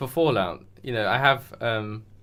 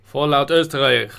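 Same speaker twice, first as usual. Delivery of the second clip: loud mock-German announcement; mock German accent; 3 semitones higher, 7 dB louder than usual. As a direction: belt it out in a joke German accent. German name for Austrian Fallout, laughs follow.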